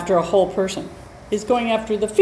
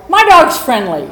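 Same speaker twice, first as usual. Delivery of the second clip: higher, louder breezy insistence; mock careless dog owner; 6 semitones higher, 9 dB louder than usual. Teacher mimicking a careless owner's breezy claim, louder. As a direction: toss it off like an oblivious owner. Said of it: "And they go".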